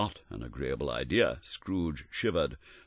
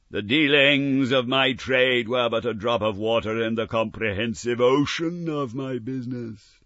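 slow, loud and higher lament; louder anguished ghostly voice; grieving ghost; 7 semitones higher, 8 dB louder than usual. Acted. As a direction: proclaim your regret like a doomed spirit. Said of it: "wringing its hands again".

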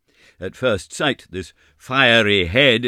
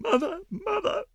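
very high, crying wail for mother; moaning cry; 4 dB quieter than usual. A narrator's reading. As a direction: wail for her mother in terror. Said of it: "Meg Giry moaned".

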